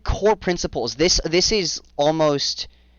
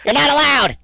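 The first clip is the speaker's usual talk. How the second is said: creature guard shouting; 12 semitones higher, 7 dB louder than usual. Acted. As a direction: yell down at them from the wall like a gleeful little creature. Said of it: "Turning around".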